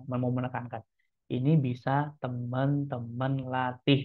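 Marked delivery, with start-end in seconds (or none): 1.3-4.0 slow, deliberate word-by-word delivery; slow right down to stress the point; slowed for emphasis. Shown as a demonstration. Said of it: "saya juga memperlambat temponya".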